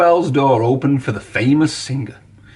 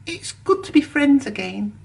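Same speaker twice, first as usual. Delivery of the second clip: higher, softer happy thanks; a higher, soft little engine making up; grateful; 11 semitones higher, 4 dB quieter than usual. A narrator's reading. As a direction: thank your friend warmly. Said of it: "said Percy".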